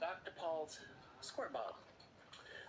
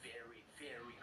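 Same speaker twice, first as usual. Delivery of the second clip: whispering; 6 semitones lower, 11 dB quieter than usual. Real, not acted. Doctor aside, voice drops.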